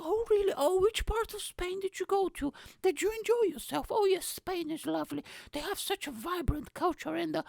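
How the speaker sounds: mocking polite friends indulging the bore, high sugary voice; polite Brits. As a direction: gush like an over-polite English listener. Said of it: "they'll be like".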